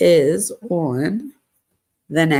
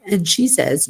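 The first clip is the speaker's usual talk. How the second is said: ordinary talk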